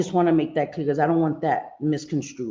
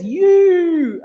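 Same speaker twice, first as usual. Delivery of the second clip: loud, high, long-drawn "You" mimicking Anne's accent; imitating Anne's accent; 12 semitones higher, 10 dB louder than usual. Host teasing Anne about how she says "You", accent imitation. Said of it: "especially when she says".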